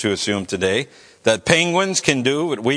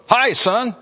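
high, bright fatherly hello, a little louder; dad's greeting; 9 semitones higher, 3 dB louder than usual. Retold or imitated. Dad answers his son's phone call, cheerful and high. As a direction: play the dad happily picking up.

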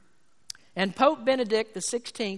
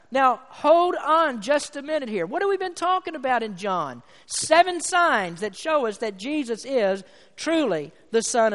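higher-pitched, emphatic pulpit delivery; impassioned preaching; 5 semitones higher, 6 dB louder than usual. Real, not acted. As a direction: preach it with mounting force, hammering key words. Preaches with rising force.

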